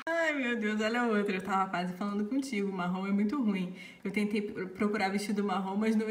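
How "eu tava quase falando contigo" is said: usual speaking voice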